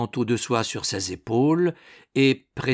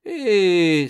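loud, high exclamation; crying out; 8 semitones higher, 7 dB louder than usual. A narrator's reading. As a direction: call out in sudden recognition. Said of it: "s'écria-t-il". (exclamation forte et haute)

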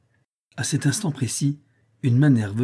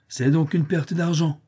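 calm stranger; 6 semitones higher than usual. A narrator's reading. Stranger concludes coolly.

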